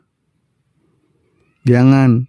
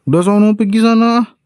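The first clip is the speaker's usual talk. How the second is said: high-pitched sulky brush-off; sulky shooing; 9 semitones higher than usual. Acted. Wife sulking at her husband, pushing him away with a high huffy voice.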